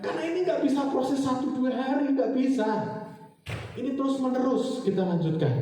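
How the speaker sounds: high, urgent pulpit delivery; emphatic preaching. Acted. Preacher exhorting the congregation to keep going, emphatic preaching. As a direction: press the point home to the congregation.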